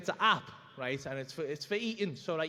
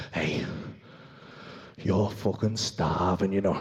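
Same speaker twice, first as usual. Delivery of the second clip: low, slow tempting inner voice; a deep devilish voice from the back of the head; sly low craving voice, dragged out; impulse control voice; 14 semitones lower, slower than usual. Acted. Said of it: "impulse control problem voice".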